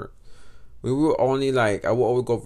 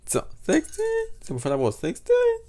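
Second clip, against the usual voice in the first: surprised. Real, not acted. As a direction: question it in disbelief. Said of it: "questioning own age claim".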